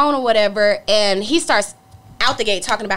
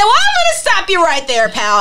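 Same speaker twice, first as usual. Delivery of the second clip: stern cutoff; 7 semitones higher, 6 dB louder than usual. Retold or imitated. Retold confrontation on phone.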